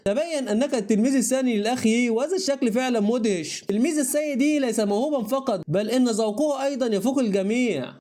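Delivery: plain reading voice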